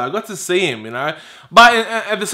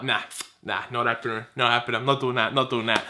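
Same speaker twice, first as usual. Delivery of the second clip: very low, soft muttering; low, quiet, to yourself; muted refusal; 9 semitones lower, 11 dB quieter, faster than usual. Retold or imitated. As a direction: refuse under your breath, repeating yourself. Retold refusal to buy rival kit.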